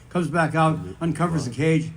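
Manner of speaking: ordinary talk, nothing marked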